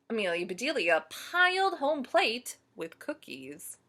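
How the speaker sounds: usual speaking voice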